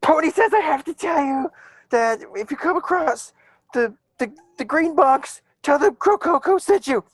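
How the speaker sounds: squeaky forced message. Acted. Griffin leaning out of the window to pass on the pony's message, high and strained.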